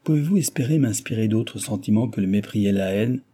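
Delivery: ordinary delivery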